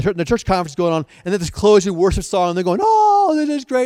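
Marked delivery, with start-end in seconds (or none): 2.8-3.8 high delighted exclaim; excited worshipper. Retold or imitated. Worshipper at the conference, high and delighted. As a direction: gush with joy in worship.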